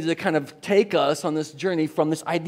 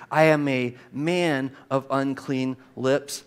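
slow, lower, deliberate reading; word-by-word reading; 5 semitones lower, slower than usual. Shown as a demonstration. Preacher shows listeners Isaiah's own words, slow and deliberate. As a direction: set out each word for study. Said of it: "Look at the word".

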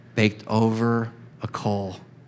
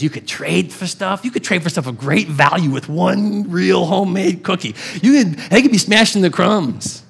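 a lightly voiced excited soldier recalling cookies; soldier brother-in-law voice; 6 semitones higher than usual. Brother-in-law tells of homemade cookies in the barracks, eager. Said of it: "He goes".

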